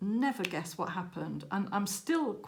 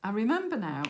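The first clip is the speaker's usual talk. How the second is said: excited realisation; 4 semitones higher, 6 dB louder than usual. Retold or imitated. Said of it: "And I said".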